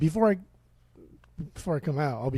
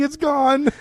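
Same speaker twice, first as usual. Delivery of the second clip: much higher, louder, slow confused wail; bewildered Ozzy; 10 semitones higher, 4 dB louder than usual. Retold or imitated. Ozzy realising the tablet dissolved, high bewildered wail. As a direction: gasp in amazement, very high.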